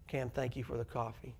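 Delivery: soft, grateful thank-you. Shown as a demonstration. Pastor demoing a grateful thank-you to the class, soft and sincere. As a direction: say it with real gratitude. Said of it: "I'm expressing gratitude".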